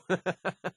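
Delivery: soft, high chuckle; amused laugh. Acted. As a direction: chuckle softly after the pirate act. Laughs after his pirate bit.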